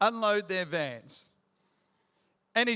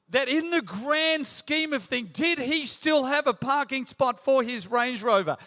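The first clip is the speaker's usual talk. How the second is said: louder, higher preaching build; rising sermon; 5 semitones higher, 3 dB louder than usual. Acted. Pastor urging men in the congregation, preaching louder and higher. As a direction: drive the point home to the congregation.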